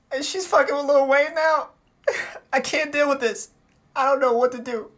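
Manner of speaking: high, mock-tearful complaint; mock distress. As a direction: act whiny and overwhelmed, as if near tears. Acts out his distressed reaction.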